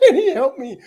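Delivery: real laugh through the words, high and loud; laughing while telling viewers about the conversation. Real, not acted.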